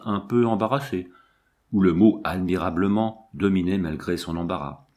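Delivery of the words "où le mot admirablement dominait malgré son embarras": plain reading voice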